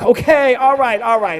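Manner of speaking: loud, high, hurried cut-in; loud protest. Acted. Ventriloquist stopping the dummy's talking joke, loud protest. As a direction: shout over the gag to stop it.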